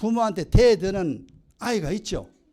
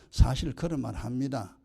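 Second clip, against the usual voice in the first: ordinary talk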